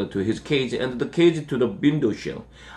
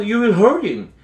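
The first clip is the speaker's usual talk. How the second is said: raised, worried protest; alarmed cry; 8 semitones higher, 4 dB louder than usual. A narrator's reading. Harold tries to stop Chester hurting the bunny, alarmed cry. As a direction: plead in a high, urgent voice. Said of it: "I cried".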